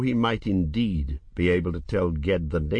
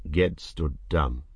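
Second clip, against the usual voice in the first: ordinary delivery, nothing marked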